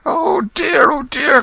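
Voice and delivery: very high, loud alarmed cry; kindly old gentleman crying out. Acted. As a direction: rush to the fallen man. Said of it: "cried the benevolent stranger".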